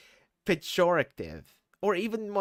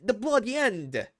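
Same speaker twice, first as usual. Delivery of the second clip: put-on British accent retry; 3 semitones higher than usual. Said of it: "Wait, no, I think I can do it".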